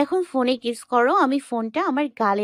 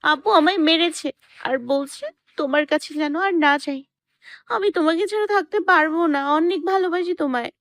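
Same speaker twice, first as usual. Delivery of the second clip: put-on very high, tearful girlfriend voice; acted crying; 14 semitones higher than usual. A narrator's reading. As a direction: plead through acted tears, pitched high. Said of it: "কান্না করতে করতে বলল". (কাঁদতে কাঁদতে বলার অভিনয়)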